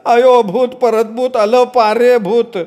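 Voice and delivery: high panicked cry of भूत; terrified yell. Acted. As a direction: shout in fear of the ghost. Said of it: "मोठ्यानं ओरडला". (घाबरून मोठ्यानं ओरडणं)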